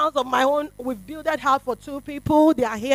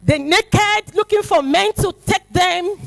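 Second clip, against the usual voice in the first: ordinary talk, nothing marked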